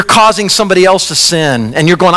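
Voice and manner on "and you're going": normal talking voice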